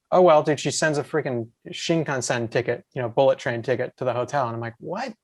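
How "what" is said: high, soft "what?" of disbelief; retold disbelief, voice leaping up quietly; incredulous. Retelling surprise at the ticket.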